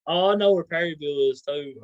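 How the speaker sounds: ordinary talk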